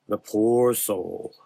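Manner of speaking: slow, otherworldly dark man; slow it down and make him eerie. Acted. Dark man voiced slowly.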